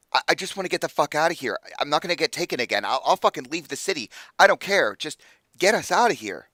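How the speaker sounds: loud, high, desperate insistence; frantic demand. Acted. Freed captive refuses to be taken again, frantic demand.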